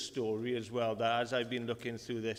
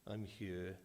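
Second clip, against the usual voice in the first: guided prayer; 3 semitones lower, 6 dB quieter than usual. Acted. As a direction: lead them gently into prayer. Leads congregation in prayer.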